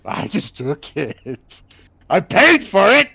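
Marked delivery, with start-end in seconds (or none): 2.1-3.1 loud cheeky boast. Pretend.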